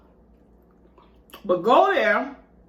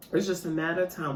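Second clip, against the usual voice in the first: ordinary talk, nothing marked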